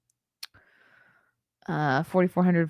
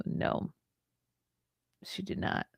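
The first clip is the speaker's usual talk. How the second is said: whispering; 11 dB quieter than usual. Acted. Scornful denial.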